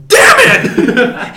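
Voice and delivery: loud, high furious yell; angry Schmidt outburst. Retold or imitated. Schmidt yelling at Coach over sponge cake, furious. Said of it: "He gets so mad".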